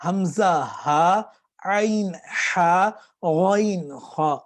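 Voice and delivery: حمزہ ہا, عین ہا, غائن خا each drawn slowly; slow letter drill. Shown as a demonstration. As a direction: chant the three letter pairs slowly. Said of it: "اس تصویر کو دیکھ لیں".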